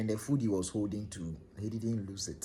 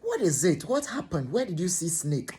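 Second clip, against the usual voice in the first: concerned questions; 6 semitones higher than usual. Retold or imitated. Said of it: "I said".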